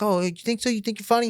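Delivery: high-pitched offended comeback; mocking imitated challenge. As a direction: imitate a rattled tough guy challenging you. Acted threat from the other man.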